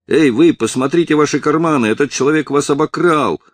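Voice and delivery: high, loud alarmed cry; shouting boy. A narrator's reading. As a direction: raise the alarm at the thief. Мальчик кричит, поймав вора. (громкий тревожный крик мальчика)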